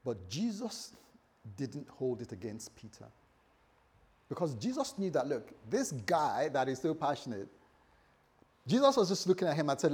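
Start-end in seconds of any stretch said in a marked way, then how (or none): none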